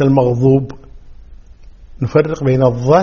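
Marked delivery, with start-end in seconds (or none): 0.0-0.7 المغضوب with a buzzing emphatic dh; za for dad. Shown as a demonstration. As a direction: replace the heavy d of المغضوب with dh. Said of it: "وليس". (المغضوب بظاء بدل الضاد)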